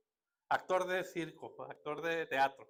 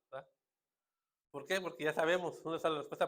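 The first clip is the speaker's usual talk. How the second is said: ordinary talk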